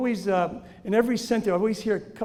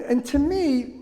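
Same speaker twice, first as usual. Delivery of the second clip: ordinary talk, nothing marked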